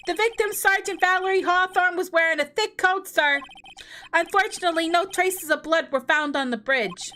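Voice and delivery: high, loud eager detective voice; gruff detective. Acted. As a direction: report to the judge like a keen cop. Detective continues testimony.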